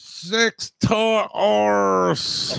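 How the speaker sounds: slow, drawn-out imitation of the caller; imitating the caller. Mimicking the odd caller's voice, slowly drawn out. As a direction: stretch every word like the caller does. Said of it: "That's a fucking unique voice".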